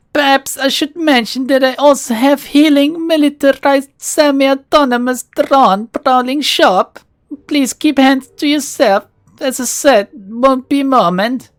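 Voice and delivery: put-on pleasant, cultured Russian-accented shopkeeper warning a visitor. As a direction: play the polite Russian shopkeeper, hamming the accent. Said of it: "There's a hint of a Russian accent".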